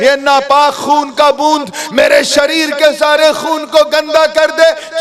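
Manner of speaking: high, loud, mounting alarm; urgent inner resolve. Acted. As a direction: think aloud with rising desperation. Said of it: "दिल में फौरन ख्याल आया". (बेचैनी से ऊँची होती आवाज़)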